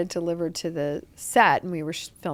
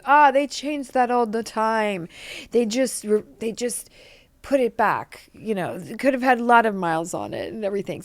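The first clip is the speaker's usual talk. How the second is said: imitating Arnold; 3 semitones higher than usual. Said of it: "He goes".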